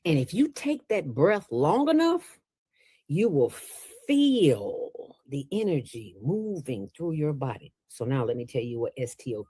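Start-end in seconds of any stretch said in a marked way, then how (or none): none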